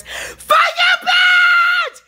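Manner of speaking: high, loud cursing scream; raw screaming outburst. Real, not acted. Lashing out at unseen tormentors, shrieking. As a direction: shriek the curse out at full force. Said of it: "This yelling and screaming".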